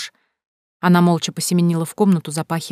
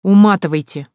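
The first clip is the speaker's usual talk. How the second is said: louder, exasperated order to leave; nerves snapped; 7 dB louder than usual. A narrator's reading. Man losing patience with relatives, exasperated. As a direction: snap and throw them out. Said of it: "Нервы сдали".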